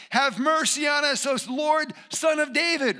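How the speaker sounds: high, loud desperate plea; crying out. Acted. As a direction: shout it out to be heard over a crowd. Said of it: "cried out, saying".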